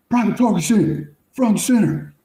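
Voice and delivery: high, loud imitation of a drill sergeant yelling; drill sergeant's yell. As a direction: call the recruit out like a drill sergeant. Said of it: "yells out".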